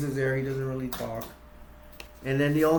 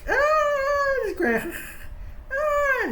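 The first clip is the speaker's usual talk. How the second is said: high squealing groans; frustrated squeal; much slower than usual. Retold or imitated. Grandpa drops the hammer.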